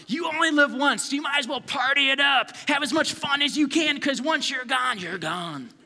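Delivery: high, loud YOLO cheer; mock party-animal hype, easing off at the end; party-lover. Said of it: "people in the world, they'll say".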